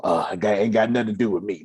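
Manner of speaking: ordinary talk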